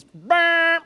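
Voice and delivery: loud, high blaring burp sound; loud imitated burp noise. Shown as a demonstration. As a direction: blurt out a short, loud noise. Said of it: "It's two noises".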